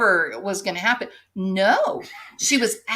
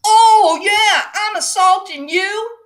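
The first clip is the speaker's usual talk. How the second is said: higher, louder sarcastic screaming; mock scream; 10 semitones higher, 8 dB louder than usual. Woman screaming at husband while hitting him, mock scream. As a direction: yell it back at him with scorn. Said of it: "as she's screaming".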